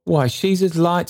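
put-on kindly old man pressing his offer. Trotty offering the stranger lodging, eagerly.

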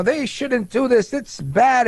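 slow, high, mocking imitation of a scolding congresswoman; mocking whine. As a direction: drag it out like a sulky child. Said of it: "she says things like".

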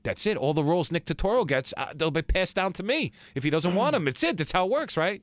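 high, eager and sure of himself; naive excitement. Retold or imitated. New in LA, thinking he'll get Nick's roles, high and eager. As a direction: gush like you've just made it. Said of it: "I was like".

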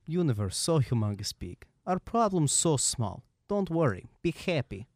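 lightly voiced Bryzgalov musing; quoting Bryzgalov. Cohost quotes Bryzgalov's famous line, lightly voiced. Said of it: "Ilya Bryzgalov once said".

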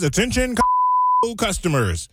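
store loudspeaker announcer. Pretend. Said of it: "the thing that says".

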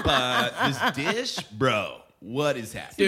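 slow California dude disbelief; California bro. Acted. Judge letting a contestant down, California bro voice. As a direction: drag it out like a California surfer. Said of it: "like, California bro".

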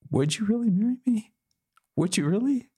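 very high, small-voiced question; incredulous pleading. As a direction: squeak it out in disbelief. Retells her anxious, disbelieving question.

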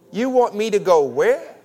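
loud, high question with "where" swooping up; inflection on WHERE. Shown as a demonstration. Preacher leading the congregation in a reply to God, incredulous. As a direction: hit "where" at the back with disbelief. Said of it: "hit that inflection on the back".

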